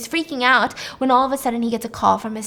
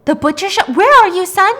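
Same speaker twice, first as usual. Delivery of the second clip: loud, high, urgent questioning; acted parental panic, rising and loud; alarmed; 9 semitones higher, 11 dB louder than usual. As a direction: demand to know, voice shooting up in alarm. Father learns son is in danger.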